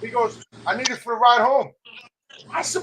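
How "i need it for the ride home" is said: higher, louder retold excuse; mock loud Ish. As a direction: play the cheerful drunk friend, pushed up.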